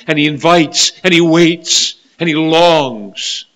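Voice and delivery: fervent preaching. Real, not acted. Preacher telling how the Savior waits, fervent. Preacher's repeated appeal reaches its peak.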